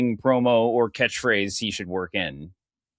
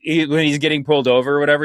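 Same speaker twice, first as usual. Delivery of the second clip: normal talking voice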